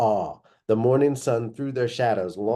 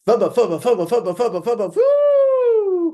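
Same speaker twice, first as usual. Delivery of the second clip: higher and louder diving chatter into a whoop; excited dactyl cry; 13 semitones higher, 7 dB louder than usual. Acted. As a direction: shriek it out as the dino dives. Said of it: "came the strange muttering in her mind".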